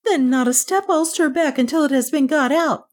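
high, agitated maid; alarmed refusal. A narrator's reading. Maid refuses to go back inside, alarmed. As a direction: refuse in a high-pitched fluster.

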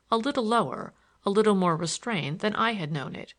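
usual speaking voice

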